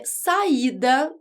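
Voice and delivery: saída stretched slowly, each vowel clear; slow example word. Shown as a demonstration. Teacher to students, drawing out saída slowly. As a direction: spell out saída slowly so the hiatus is heard. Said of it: "porque saída é um hiato".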